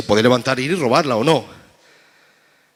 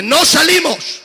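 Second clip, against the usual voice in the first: defiant demon; 13 semitones higher, 7 dB louder, much slower than usual. Acted. Demon refuses again.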